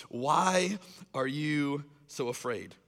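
drawn out, word by word; slow, searching question. Acted. Jesus looks at you and asks, slow and searching. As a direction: ask it slowly and let it hang.

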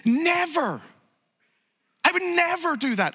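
high, loud, emphatic refusal of God. Acted. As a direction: declare it forcefully, as God swearing never. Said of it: "God responds emphatically".

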